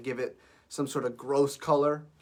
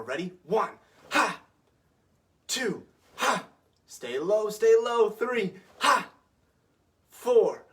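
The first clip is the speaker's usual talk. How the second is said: counting off punches, then a sharp high shouted ha on each; shouted kiai drill; 4 semitones higher, 6 dB louder, much slower than usual. Shown as a demonstration. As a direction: bark each count and blast out a loud, high ha with every punch. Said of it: "Notice how I'm breathing when I punch".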